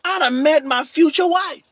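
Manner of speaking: excited friend. Retold or imitated. Friend telling the preacher he met his future wife, high and excited. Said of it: "He said".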